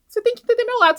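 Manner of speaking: high, loud, desperate plea; pleading mother. Retold or imitated. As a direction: beg him to see your side.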